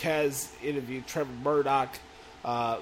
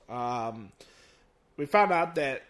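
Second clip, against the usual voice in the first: ordinary delivery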